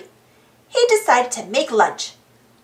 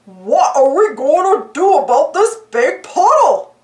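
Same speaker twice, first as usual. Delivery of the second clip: dopey dog voice, a bit louder; Goofy voice; 4 dB louder than usual. A narrator's reading. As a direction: ask it like a goofy clumsy dog. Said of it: "Goofy asked".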